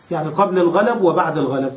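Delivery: ordinary talk, nothing marked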